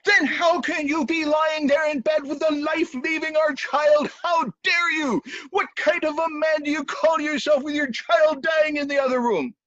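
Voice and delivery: high-pitched scolding shout; outraged, high and loud tirade; furious wife. Acted. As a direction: tear into your husband at full pitch. Wife berates husband in bed.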